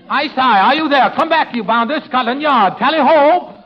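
English father. Acted. Pupil repeating the father's lines for his coach, mock English indignation. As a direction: have a go at the outraged English father. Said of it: "Okay, Jack, here goes".

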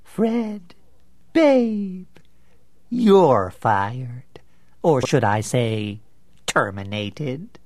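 slow, mocking sneer; sneering contempt. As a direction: sneer every word at him. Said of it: "with a sneer of contempt".

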